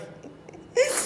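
squealing airy laugh; high breathless laugh. Real, not acted. Laughing at friend's story.

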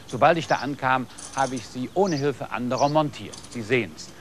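ordinary talk

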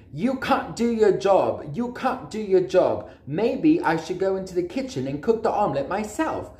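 high-pitched mock scream at the server; imitating a diner's tantrum, not fully shouted; hammed-up celebrity tantrum; mock yelling. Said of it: "started screaming".

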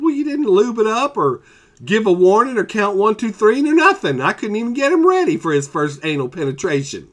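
high, loud, outraged telling-off; indignant rant. Retold or imitated. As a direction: pile on the outrage at the careless vet. Owner ranting at the vet.

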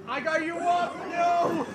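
high, loud cry of protest; dismayed shout. Real, not acted. Player dying while reviving teammate, dismayed shout. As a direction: wail it loudly at the loss.

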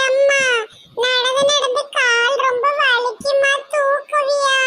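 put-on high, slow little-kid whine; whining child. Child asks mother to carry them, whining. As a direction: plead like a tired small child.

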